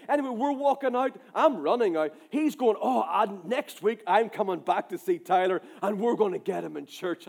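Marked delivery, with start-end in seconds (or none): none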